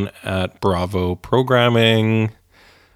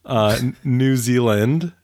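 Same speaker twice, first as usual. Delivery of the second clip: ordinary talk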